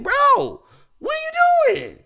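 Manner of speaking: very high shouting disbelief; exasperated yell. Pretend. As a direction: shriek at him to shoot. Imagined yelling at teammate.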